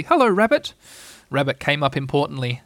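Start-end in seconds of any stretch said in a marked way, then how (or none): 0.0-0.7 high, loud squeaky relief; a little pig spotting help; glad Piglet. A narrator's reading. Seeing Rabbit arrive, a high glad Piglet.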